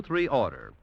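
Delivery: ordinary delivery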